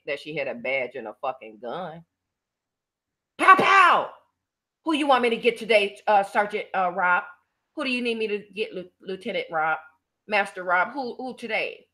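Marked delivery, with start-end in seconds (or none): 3.4-4.1 high, loud gunshot noises; mock gunshots. Mocking her fake cop gun. 4.8-11.9 mock eager cop. Mocking her as cop.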